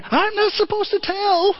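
high, hesitant angel voice; coy Clarence. Retold or imitated. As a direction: Clarence evades George's question, high and timid. Clarence answering George.

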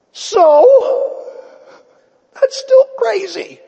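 high, loud cracking outcry; disbelief. Real, not acted. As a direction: squeal it in disbelief. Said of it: "preacher's outcry".

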